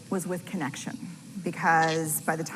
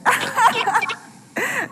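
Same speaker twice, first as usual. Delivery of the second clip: high, loud imitated cackle; mocking laugh; 10 dB louder than usual. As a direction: burst into a mock scoffing laugh. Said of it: "And she went".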